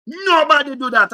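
loud, high, slow "Nobody do that"; real outrage, near shouting; indignant shout. Real, not acted. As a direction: yell it in outrage. Indignant rant about language.

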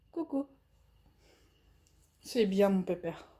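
talking to a dog. Real, not acted. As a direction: soothe the dog gently. Soft praise to dog.

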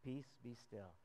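low, soft, calm authority; calm command. Acted. Jesus calming the wind and sea, soft low command. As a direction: still the storm gently.

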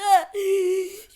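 loud high gasp of pain. Pretend. Character gasps loudly after breaking a leg. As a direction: gasp as if hurt.

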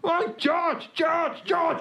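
high mock calling of George; family calling. Comic retelling his family calling from the car, high mock call. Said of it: "I heard him going".

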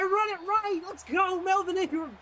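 loud, high victory shout; excited cheer. Real, not acted. Gamer cheering his defender on, loud and high. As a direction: yell it out like your guy just saved the game.

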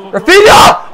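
high, rising yell of a name; sudden high shout; excited shout. Real, not acted. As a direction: call out sharply to a player. Calling out to his player.

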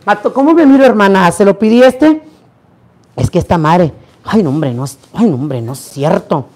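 normal talking voice

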